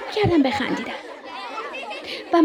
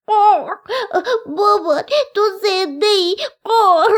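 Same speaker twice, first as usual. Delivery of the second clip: high, cawing little crow; distressed baby-bird voice; tearful young crow; 7 semitones higher, 4 dB louder than usual. A narrator's reading. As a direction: cry out to a parent with crow caws. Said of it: "گریه کنان پیش مادرش رفت و گفت".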